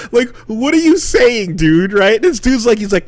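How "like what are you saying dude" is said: high-pitched, retold bewilderment; incredulous questioning. Tourist questions the cryptic Dublin local, incredulous questioning. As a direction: press for an answer, pitched up in disbelief. Retelling his demand for an explanation.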